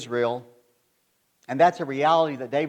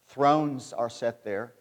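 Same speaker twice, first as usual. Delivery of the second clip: Thrones drawn out very slowly and softly; stress on thrones; 3 semitones lower, 3 dB quieter, much slower than usual. Shown as a demonstration. Pastor dwelling on the verse, slow hushed stress on Thrones. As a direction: linger on Thrones, quiet and grave. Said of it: "A reference to Jerusalem as the seat of political power".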